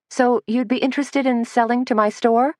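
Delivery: light and breezy. A narrator's reading. Suzanne fakes casual chat to fool the leader, breezy. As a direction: act breezy to defuse trouble. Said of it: "trying to make her voice sound light and breezy".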